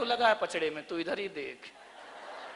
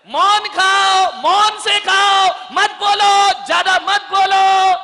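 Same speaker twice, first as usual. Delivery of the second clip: preaching shout; 11 semitones higher, 13 dB louder, slower than usual. Real, not acted. Preacher commanding silence at meals.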